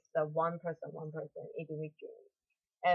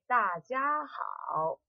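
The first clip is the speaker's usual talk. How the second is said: slow demo; 5 semitones higher, much slower than usual. Shown as a demonstration. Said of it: "Then you can say".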